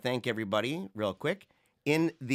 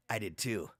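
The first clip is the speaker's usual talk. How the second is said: much lower and softer, knowing and mysterious; hammed-up conspiracy hint; mock cryptic guru; 7 semitones lower, 9 dB quieter than usual. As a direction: drop low and hush like you share a secret. Retelling Coffin's cryptic video line.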